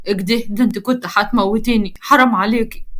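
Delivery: ordinary delivery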